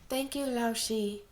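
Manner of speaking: slow, lightly voiced group chorus; a hint of girls thanking in unison.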